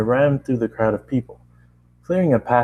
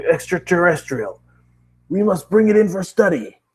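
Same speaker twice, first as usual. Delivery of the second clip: megaphone shout; 8 semitones higher than usual. Acted. Gruff police captain shouting at the crowd through a megaphone. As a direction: bark orders through a megaphone. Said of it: "shouting it".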